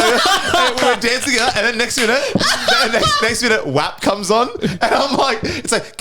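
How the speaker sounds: high-pitched giggling through the words; can barely get it out for laughing. Real, not acted. Cracking up telling mates about dancing to WAP.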